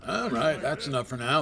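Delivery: loud mock repeat of the line; echoing the game voice. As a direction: parrot the character's line loudly.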